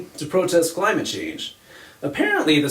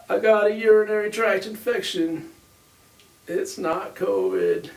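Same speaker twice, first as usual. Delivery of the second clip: President Clinton; 4 semitones higher than usual. Acted. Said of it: "President Clinton was like".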